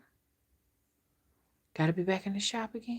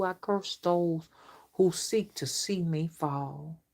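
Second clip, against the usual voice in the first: curse incantation; slower than usual. Acted.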